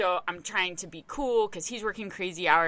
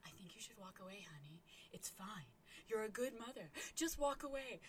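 whispered, much softer reassurance; 17 dB quieter than usual. Retold or imitated. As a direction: hush her daughter gently. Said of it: "my mom's like".

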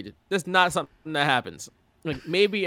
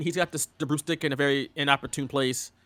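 ordinary talk

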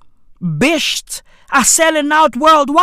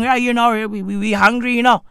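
quick, monotone Merseyside grumble; mock Merseyside accent; faster than usual. Struggling Merseyside local listing hardships, mock Merseyside accent. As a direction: run it together in a put-on Merseyside accent. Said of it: "what accent is this?".

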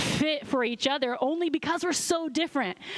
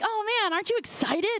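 much higher, eager pleading; coaxing excitement; 9 semitones higher than usual. Retold or imitated. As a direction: try to pump up a flat husband. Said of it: "And I'm like".

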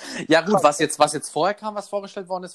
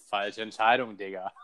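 ordinary talk, nothing marked

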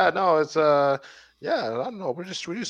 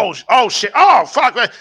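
mock announcer panic; 7 semitones higher, 7 dB louder than usual.

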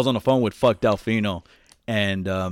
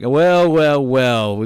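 drawn-out taunting well, well, well; mock taunt; 2 semitones higher, 5 dB louder, much slower than usual. Teasing a friend who called seventeen minutes late, mock taunt. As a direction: tease him for calling late. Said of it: "I was like".

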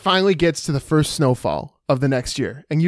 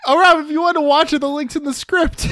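teasing; 10 semitones higher than usual. Real, not acted. Teasing friend about spoilers.

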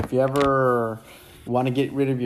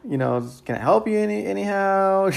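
higher-pitched, hesitant mock politeness; awkward, tentative offer; 9 semitones higher than usual. Salesman offering help as door closes, awkwardly tentative. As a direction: trail upward as if the door is closing.

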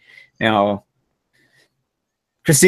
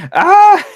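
loud yelp; 8 dB louder than usual. Real, not acted. Laughing outburst over podcast memory.

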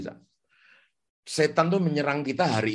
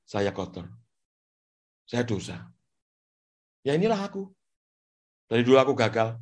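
guilty inner voice; 6 semitones lower than usual. Retold or imitated. Listener's inner voice of shame, low and subdued. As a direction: murmur defeat to yourself. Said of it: "seakan-akan itu kamu".

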